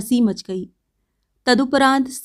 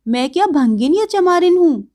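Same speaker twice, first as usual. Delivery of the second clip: indignant retort; 6 semitones higher, 4 dB louder than usual. Acted. Bijli stung by refusal.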